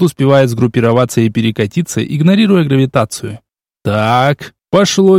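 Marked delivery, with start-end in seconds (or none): none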